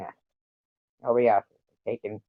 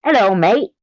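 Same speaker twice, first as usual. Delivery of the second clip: higher, louder Australian "Hello, mate"; Australian accent; 7 semitones higher, 5 dB louder than usual. Acted. Streamer doing an Australian alien man for chat. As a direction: chirp it like an Aussie. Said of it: "Australian alien man".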